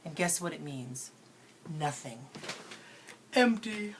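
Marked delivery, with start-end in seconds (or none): 3.3-3.9 a high, hollow, mock-empty "Empty". Teacher acting lazy for students, a mock hollow sigh. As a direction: act out feeling empty for the class. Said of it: "how I feel when I'm lazy".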